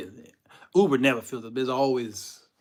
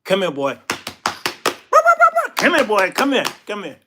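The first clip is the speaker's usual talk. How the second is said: loud, short commands jumping higher; Putin calling a dog; 9 semitones higher, 12 dB louder than usual. Acted. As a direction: summon him like a pet dog. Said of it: "Putin will be like".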